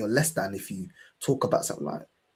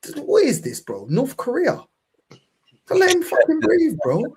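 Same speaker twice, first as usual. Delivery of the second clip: higher and louder, building outrage; exasperated rant; 13 semitones higher, 4 dB louder than usual. Real, not acted. Telling friends about his cousin's control, heated rant. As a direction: vent it, voice climbing.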